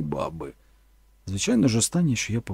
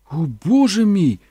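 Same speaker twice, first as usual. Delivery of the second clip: slow, louder, higher alarmed cry; 6 semitones higher, 6 dB louder than usual. Acted. Mother realises the press is burning, alarmed cry. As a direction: gasp it out in panic. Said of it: "закричала мама".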